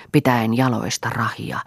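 ordinary delivery

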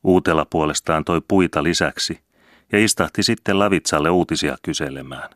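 ordinary delivery

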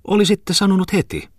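very high-pitched, acted hostess. A narrator's reading. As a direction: pitch it right up for the exclaiming hostess. Hostess replying in voiced dialogue.

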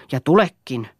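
touch of spite; nasty, rasping. A narrator's reading. Husband threatening his wife, mildly nasty. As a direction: let a little malice into it. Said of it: "Hänen äänensä korahteli jo ilkeänä".

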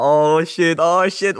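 hammed-up awkward realisation; mock dismay. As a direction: groan it out like you've been caught. Acts out his awkward panic.

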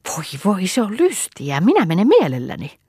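put-on high, gleeful child's voice; delighted. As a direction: pipe up like an eager little girl. Vappu hops with delight. (innostuneena, korkealla lapsen äänellä)

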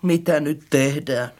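low, soft, dejected question; crushed. A narrator's reading. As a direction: ask it low and defeated. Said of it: "hän kysyi murtuneena".

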